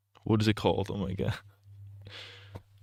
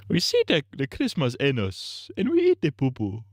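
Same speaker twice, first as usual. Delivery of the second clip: mock Nigerian accent; 4 semitones higher than usual. Streamer's mock Nigerian accent questioning Kwanzaa, very high.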